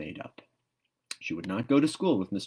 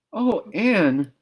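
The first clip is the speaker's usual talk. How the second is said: high, loud cry of dismay; a girl on the verge of tears; near tears; 12 semitones higher, 8 dB louder than usual. A narrator's reading. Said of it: "looked as if she were ready to cry".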